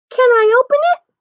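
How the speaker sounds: very high, tiny bear voice; eager Baby Bear. A narrator's reading. Baby Bear asks to open his present, tiny high voice. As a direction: pipe up like a little cub.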